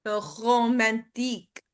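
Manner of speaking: The romantique with a gargled French R; French accent. Shown as a demonstration. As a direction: roll the R of romantique in the throat. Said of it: "can you kinda try that?".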